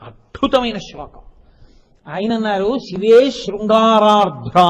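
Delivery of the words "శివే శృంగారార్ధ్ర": "శివే శృంగారార్ధ్ర" chanted slowly and louder, "శివే" falling; intoned quote. Acted. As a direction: savour "శివే శృంగారార్ధ్ర" slowly like a recited line. Requotes verse opening slowly.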